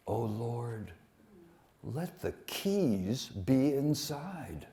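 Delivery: slow, soft poetry recital; poem reading. Acted. Bob reads his haiku to the audience, slow and soft. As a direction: let each line hang, slow and hushed.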